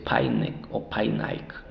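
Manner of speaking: "Painek o Painaik" with each marked i as English "eye"; wrong long-I sound. Shown as a demonstration. Teacher warns students off the long I, "Painek o Painaik" mispronounced with "eye". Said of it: "aquí no podemos decir".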